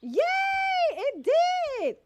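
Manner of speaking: high, loud delighted cheer; excited cheer. Real, not acted. Streamer cheering when the chat command works, high and loud. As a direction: celebrate the win.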